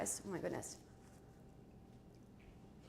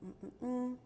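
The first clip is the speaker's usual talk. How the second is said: ordinary delivery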